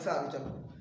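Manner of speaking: ordinary delivery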